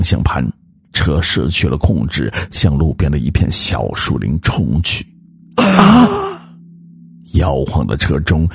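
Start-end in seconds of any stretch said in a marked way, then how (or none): 5.6-6.2 high, loud shriek of fright; terrified scream. Acted. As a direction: scream out in sudden terror. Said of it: "女子惊声的尖叫". (惊恐尖叫)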